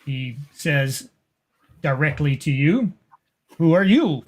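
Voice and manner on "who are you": put-on lightly accented, not quite German. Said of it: "not quite a German accent".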